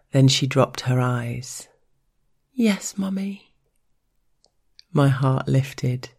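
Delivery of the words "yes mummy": high submissive whisper. Acted. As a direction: whisper it meekly, higher. Girl submits to Mummy.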